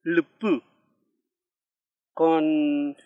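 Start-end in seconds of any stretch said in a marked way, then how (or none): 0.0-0.6 "lupu" with sixth vowel leaning into the L; vowel after L. Shown as a demonstration. Teacher gives an example with L, careful demonstration. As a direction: demonstrate "lupu" as a separate model word. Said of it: "Con la L podría ser".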